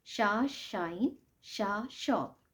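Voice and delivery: "शा शाइन, शा शॉप" drawn out, sound first then word; slow phonics drill; phonics demo. Shown as a demonstration. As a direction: sound out "शा" slowly before each word. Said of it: "वो बनती है शा".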